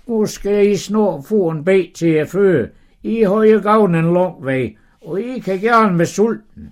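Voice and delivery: high, loud, hearty host; hospitable host. Acted. Abraham inviting the tired guests to eat, high and hearty. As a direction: press the food on the guests heartily.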